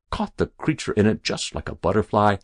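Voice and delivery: usual speaking voice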